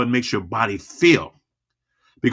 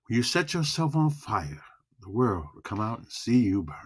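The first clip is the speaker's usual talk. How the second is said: soft, low, emotional line; soft, slow, low delivery; 9 dB quieter than usual. Shown as a demonstration. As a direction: hush it down, let it land. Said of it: "we got low and slow".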